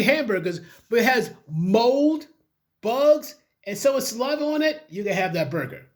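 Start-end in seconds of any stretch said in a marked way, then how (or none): none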